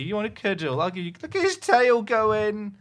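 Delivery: ordinary talk, nothing marked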